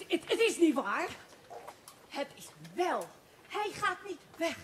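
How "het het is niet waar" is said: ordinary delivery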